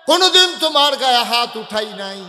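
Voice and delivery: high, loud sustained sermon chant; full-force preaching. Acted. Preacher voicing the Prophet to Ayesha, full-force chant. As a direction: cry it out loud and high, holding the notes.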